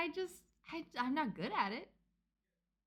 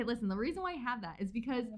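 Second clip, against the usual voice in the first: usual speaking voice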